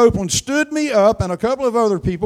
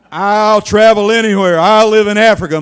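bold confession; 10 dB louder than usual. Retold or imitated. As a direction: declare it like a bold promise. Congregation's confession of dedication.

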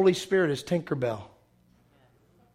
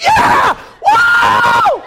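very high, loud whooping cheer; teenage excitement; 13 dB louder than usual. Retold or imitated. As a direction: explode like a thrilled teenager in the cinema. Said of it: "I'm a fifteen-year-old. I'm like".

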